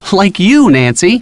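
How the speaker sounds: usual speaking voice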